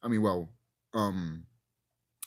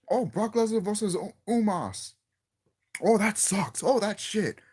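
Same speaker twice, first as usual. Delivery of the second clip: higher-pitched mock complaining; mocking overreaction; 7 semitones higher than usual. YouTuber imitating podcasters panicking over news, mock griping. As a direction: imitate fans griping too soon.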